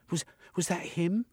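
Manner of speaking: high, breathless woman's question; a scared young woman asking; Missus, frightened. A narrator's reading. Frightened woman asks Jeremy about the attacker. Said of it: "she asked".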